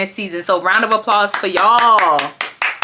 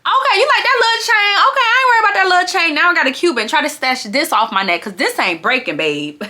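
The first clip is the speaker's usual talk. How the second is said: high, fast sassy taunt; taunting the chain snatcher, voice way up; 5 semitones higher than usual. Retold or imitated. Vlogger voicing Jazz taunting Summer, high sassy taunt. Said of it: "And I said".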